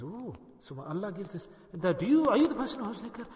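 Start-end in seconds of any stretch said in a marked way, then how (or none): 0.0-1.5 imitating an onlooker, very soft; hushed reaction. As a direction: drop to a soft, quoted aside.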